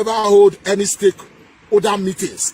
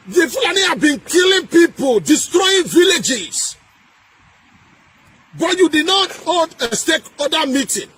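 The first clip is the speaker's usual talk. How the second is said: shouted, slowed on destroying villages; angry shout; 5 semitones higher than usual. Real, not acted. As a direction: rage at the killings. Angry rant about killings.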